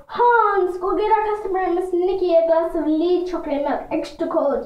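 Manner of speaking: high-pitched commanding salon owner; fake French salon owner. A narrator's reading. Salon owner sends Hans for a customer's drink, high bossy call. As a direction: call out the order in a high put-on voice.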